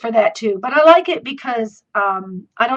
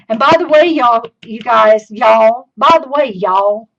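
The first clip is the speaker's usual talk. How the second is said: southern accent; 6 dB louder than usual. Real, not acted. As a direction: let the Southern accent pour out, louder. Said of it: "my southern accent's coming out".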